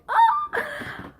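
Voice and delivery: the ah jumps way up in a squeal; delighted high squeal; excited squeal. Real, not acted. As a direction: squeal at the pretty box. Squeals at the pink box.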